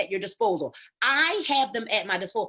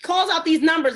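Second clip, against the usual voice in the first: ordinary talk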